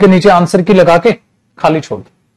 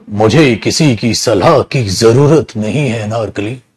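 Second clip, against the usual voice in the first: lower, slow, grand Mughal emperor voice; filmi emperor line; 7 semitones lower, 3 dB quieter than usual. Acted. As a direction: declaim it like a stern king from an old film. Mughal emperor rebuffing Anarkali, filmi.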